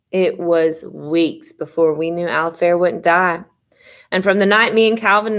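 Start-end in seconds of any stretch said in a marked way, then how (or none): none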